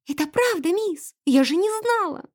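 high, pleading maid's voice; tearful. A narrator's reading. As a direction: insist innocently, about to weep. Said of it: "И она тихо заплакала". (плачущим высоким голосом)

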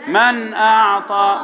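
slow, intoned tajweed model; steady, drawn-out recitation; recitation to repeat. Shown as a demonstration. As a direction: chant it clearly for students to echo. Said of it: "قول وراي".

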